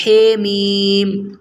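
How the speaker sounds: ہے میم said Urdu style, not حامیم; mock-wrong ہے میم; wrong pronunciation. Shown as a demonstration. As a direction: incorrect letter name ہے میم. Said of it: "یوں نہیں پڑھتے".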